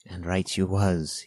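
ordinary delivery, nothing marked

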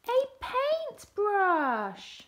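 loud, high, drawn-out announcement; storyteller's big surprise; triumphant reveal. A narrator's reading. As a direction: stretch it out as a grand reveal. Big reveal in picture book.

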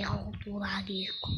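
unvoiced, breathy whisper. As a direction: whisper it like a secret. Whispered English aside.

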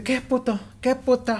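high-pitched mock taunts, held back in volume; taunting taxi driver. Taxi driver insulting him through the window, mock taunting. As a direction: imitate a driver cursing from another car.

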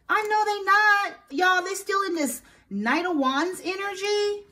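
"Y'all" pulled long and high in a slow scoff; scoffing disbelief. Real, not acted. Scoffing at the ex's intentions.

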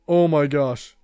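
ordinary delivery

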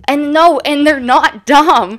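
firm loud correction; emphatic correction. Real, not acted. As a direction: push back on your own words. Correcting herself loudly.

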